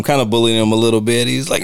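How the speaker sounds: usual speaking voice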